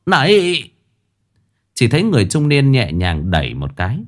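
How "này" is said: high, fast, rising panic; panicked pleading. Acted. Minh Hải begging the spirit to wait, high panic. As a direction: cry out to stop him.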